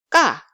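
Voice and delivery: hard Korean tense "ga". Shown as a demonstration. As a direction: pop out "ga" without puff. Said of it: "let's just read each sound".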